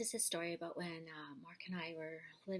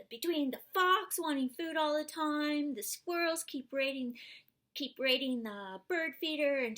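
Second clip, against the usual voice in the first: higher and louder, worked-up, overwhelmed; exasperated complaint; 6 semitones higher, 9 dB louder than usual. Retold or imitated. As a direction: pour it out like a frazzled hermit. Meditator complaining, overwhelmed, retold.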